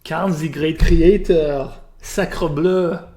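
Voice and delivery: loud, high, hammed-up French accent; mock French accent. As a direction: bellow it like an outraged Frenchman. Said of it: "wants me to speak with a French accent".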